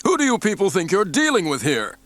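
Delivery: raging. A narrator's reading. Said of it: "he raged".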